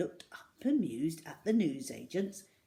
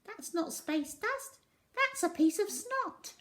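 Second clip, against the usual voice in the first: put-on a sceptical old customer setting him straight; unconvinced old lady; 13 semitones higher than usual. A narrator's reading.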